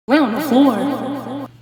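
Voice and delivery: slow, incredulous, through a laugh; slow laughing echo of the order; laughing disbelief. Real, not acted. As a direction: drag it out, laughing at how absurd it is. Repeats nurse's absurd order.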